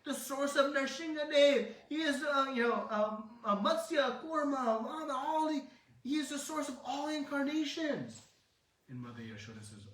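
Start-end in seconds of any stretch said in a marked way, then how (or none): 0.0-8.2 much higher, louder and laughing through the list; excited boasting. Acted. Balaram bragging to Yashoda about Krishna, high excited voice. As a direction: proudly list his brother's incarnations. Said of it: "he's like".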